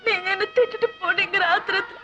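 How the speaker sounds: crying through the words; wife weeping to husband on phone; sobbing confession. Acted. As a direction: confess it between sobs. Wife confesses drinking poison.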